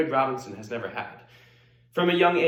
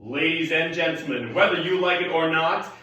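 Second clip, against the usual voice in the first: loud, rising announcer's introduction; show announcer; 5 semitones higher, 6 dB louder than usual. Acted. Announcer presenting Hedwig to the crowd, loud and grand. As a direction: build to a big shouted name reveal.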